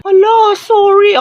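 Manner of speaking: high, drawn-out loud "sorry"; panicked apology. Acted. Student apologizing for the forgotten report, panicked. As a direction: wail the apology, then ease down.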